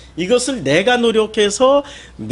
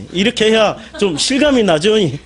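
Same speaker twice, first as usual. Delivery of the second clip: usual speaking voice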